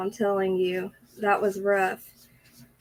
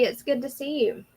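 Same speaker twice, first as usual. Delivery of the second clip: ordinary talk, nothing marked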